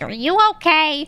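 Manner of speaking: high, drawn-out worried question; exaggerated pitying check-in; mock concern. Acted concerned people hearing low tone.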